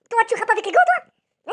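incredulous jeer at the rapper; mocking squeal. Real, not acted. Mocking rapper [name] for rapping in gloves: high jeer.

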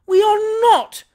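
very high, loud denial; indignant roar. A narrator's reading. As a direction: roar it back at the crowd. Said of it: "roared Pickwick".

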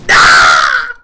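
very loud triumphant yell; victory yell. Real, not acted. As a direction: roar out the win. Wins the fight.

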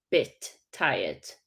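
slow "bit tired" with a short crisp i in "bit"; careful bit tired. Shown as a demonstration. Teacher models "bit" for learners, slow and careful. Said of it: "Zwróćcie uwagę na słówko bit".

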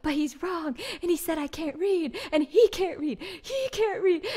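high, loud, petulant outrage; indignant shout. Retold or imitated. [name] arguing with her friends about the drunk man, indignant shouting. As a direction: insist like a wronged kid. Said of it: "I was like".

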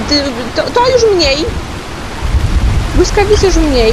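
loud, high frightened cries; panicked yelling. Real, not acted. Gamer scared by the storm, yelling in panic. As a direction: shout it out in real panic.

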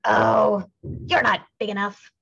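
high, loud dismissive brush-off; scornful ladybug. A narrator's reading. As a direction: wave them off with contempt. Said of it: "said the grouchy ladybug".

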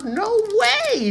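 sudden high-pitched no way; stunned squeal of disbelief; surprised. Real, not acted. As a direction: blurt it out in disbelief. Reacting to a big pull.

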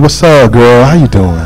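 low, smooth-talking phone pickup voice. Retold or imitated.

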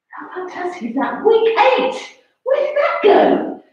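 high, bright disbelief that soars up; gushing over how fast time flew; excited amazement. Real, not acted. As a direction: cheer the class on with astonishment. Celebrating week eight of training.

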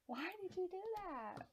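high, whiny disbelief; high-pitched dismay; exasperated protest. Real, not acted. Repeats her complaint at the game.

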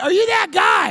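high, loud demand to confirm; insistent mock-fan holler; shouting stranger. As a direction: pester him with a high-pitched yell. Stranger repeats question, acted.

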